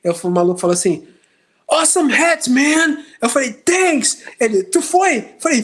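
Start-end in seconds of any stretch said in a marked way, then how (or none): 1.7-3.0 high, thrilled "Awesome hat"; mock excited fan. Retold fan greeting. 3.5-4.2 high, bright "Thanks"; match the stranger's excitement; mock excited reply. Retold own reply, excited. 4.6-5.2 high, eager "Tu foi"; mock excited question. Retold fan question.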